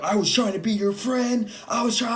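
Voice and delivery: high-pitched, overwrought protest; super crazy. Retold or imitated. Coworker getting super crazy over friendship, super crazy. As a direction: mimic an unhinged man pleading his case. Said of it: "super crazy".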